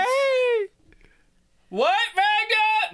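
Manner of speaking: very high, drawn-out holler; long, high-pitched shout; full in-game yell. Acted. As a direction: yell back as loud as you really would. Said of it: "yell as loud as you would".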